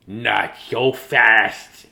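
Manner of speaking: very slow, loud, menacing; unpleasant hiss. Acted. Mean substitute stopping the kids, slow hiss. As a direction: drag it out like a witch. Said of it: "hissed an unpleasant voice".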